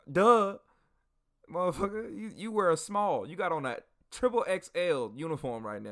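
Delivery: high, punchy retort to the obvious; mock exasperation. Soldier tells a small comrade about the huge uniform, mock exasperated. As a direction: act out being fed up with a fool.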